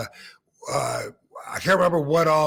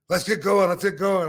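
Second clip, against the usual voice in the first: urgent; 5 semitones higher, 5 dB louder than usual. Retold or imitated. Fonzie urging escape, phone ringing.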